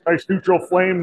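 normal talking voice

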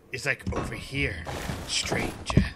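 sly shopkeeper tempting a stranger; merchant voice. Pretend. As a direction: lure the stranger in like a shady merchant. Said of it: "I'm doing my, uh, merchant voice again".

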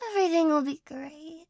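hushed reassuring whisper. Acted. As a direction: breathe it into their ear. Repeated softly as a promise.

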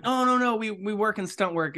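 calming the cop down; hasty reassurance. Retold or imitated. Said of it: "I'm like".

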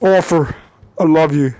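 low, solemn declaration; deep, tender role-play voice; mock last words. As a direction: play a dying outlaw's farewell.

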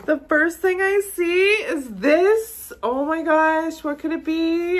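high, loud excited amazement; thrilled disbelief at a gift; freaking out. Real, not acted. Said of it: "I'm freaking out".

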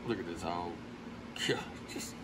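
softly, so the hog isn't spooked; hushed, barely voiced. Real, not acted. Hunter hushing at the sight of the hog, hushed. Hog arrives at night trap.

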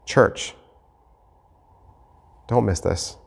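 ordinary talk

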